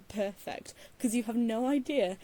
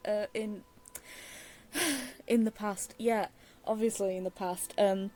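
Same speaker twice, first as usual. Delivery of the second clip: ordinary talk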